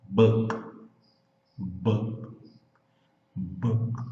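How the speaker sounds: Book with the K nearly silent; killed K. Shown as a demonstration. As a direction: let the K of Book fade out. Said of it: "você mata o som do K".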